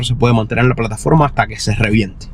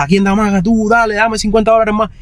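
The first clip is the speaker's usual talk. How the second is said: rapid auctioneer; 10 semitones higher than usual. Retold or imitated. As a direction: rattle off bids like an auctioneer. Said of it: "como que la apuesta".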